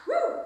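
high loud cheering woo; excited woo. Real, not acted. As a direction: whoop with joy at the finish. Finishing the set.

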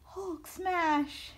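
lightly hammed-up Hulk cry; Hulk smash. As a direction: play a brute yelling at the marker. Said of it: "they're just like".

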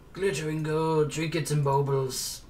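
game narrator impression. Player imitating the game's narrator over found treasure, theatrical narrator voice. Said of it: "I fucking love how he says that".

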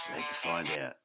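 ordinary delivery

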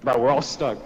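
usual speaking voice

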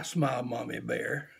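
ordinary delivery

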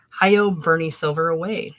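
loud, drawn-out hero's call. Acted. A cabbie's punchline, a voice way off down the block, calling out. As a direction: call it out long, like someone off down the block. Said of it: "It's calling".

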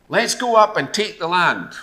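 high, loud urging; rally the people to march; rallying call. Acted.

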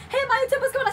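high, eager hey; excited greeting. Retold or imitated. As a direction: call out to her like a starstruck fan.

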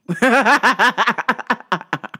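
rapid bursts of laughter; real giggling fit; big laugh. Real, not acted. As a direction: crack up at your own bit. Cracking up at own joke.

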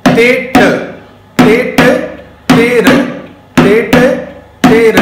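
तेट and तेर called out crisp and loud; recited tabla bols. Shown as a demonstration. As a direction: rap out तेट, तेर one by one. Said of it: "pronunciation का फर्क है".

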